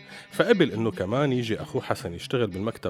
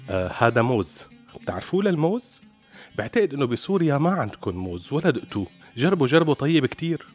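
loud, patronizing offer; condescending host; 5 semitones higher, 5 dB louder than usual. Retold or imitated. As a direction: push the banana on him, talking down. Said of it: "وقال له".

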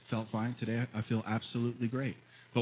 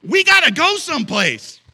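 put-on angry kid shout; 14 dB louder than usual. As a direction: yell at Dad to hurry up. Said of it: "he got mad at me".

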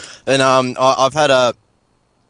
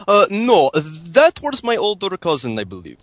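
fake Russian accent. Prank caller phoning hosts in fake Russian accent. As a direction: play a hammed-up Russian caller. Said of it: "was a good Russian accent".

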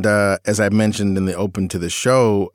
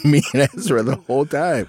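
ordinary delivery

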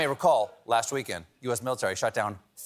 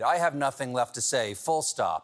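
ordinary talk

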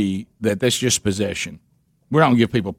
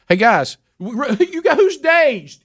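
high-pitched laughing denial, louder; laughing protest; 13 semitones higher, 3 dB louder than usual. Real, not acted. Dad laughing off being dazed to his family, high and giggly. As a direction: squeal it through laughter.

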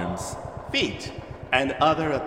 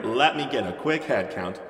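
usual speaking voice

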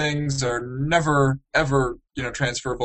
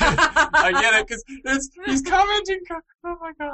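high squealing laugh through words; 14 semitones higher than usual. Real, not acted. Laughing at commenting joke.

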